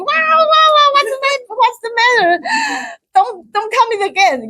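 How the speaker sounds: high, mock alarmed cries; mock panicked yelling. Mother mocking her own panic to the listener, high yelling. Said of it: "I always be yelling".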